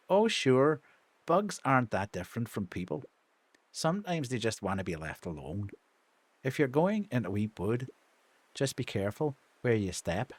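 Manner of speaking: gentle Irish character, dropping low; low, easy Northern Irish voice; Irish accent. Acted. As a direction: voice him with a Derry lilt. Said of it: "give him an Irish accent".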